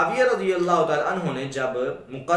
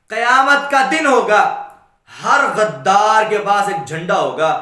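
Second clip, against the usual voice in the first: loud, raised sermon delivery; full-force preaching; 5 semitones higher, 7 dB louder than usual. Real, not acted. Preacher telling how Ibn Umar gathered his family, full-force preaching. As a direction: proclaim it forcefully to the congregation. Preacher retells a solemn address.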